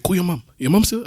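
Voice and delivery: high insistent Moroccan voice; imitated Moroccan accent. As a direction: urge it in a put-on Moroccan accent. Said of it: "he's saying this in his accent".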